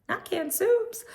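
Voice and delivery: high, shocked squeal of disbelief; shrieking pity over canned soup; mock horror. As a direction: squeal it like it's a tragedy. Repeats horrified quote.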